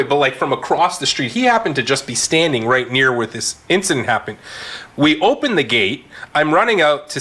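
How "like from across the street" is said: ordinary talk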